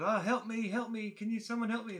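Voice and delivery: put-on high pleading voice of a creature faking a child; child crying for help. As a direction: call out like someone pretending to need help. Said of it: "make 'em sound like they're".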